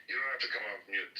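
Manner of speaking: ordinary talk, nothing marked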